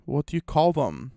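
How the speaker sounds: normal talking voice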